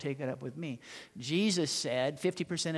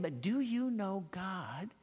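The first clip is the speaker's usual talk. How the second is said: put-on slow, innocent child asking again; a small boy repeating his question; lightly voiced child; little boy voice; 5 semitones higher, much slower than usual. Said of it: "says it again".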